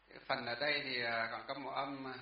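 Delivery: usual speaking voice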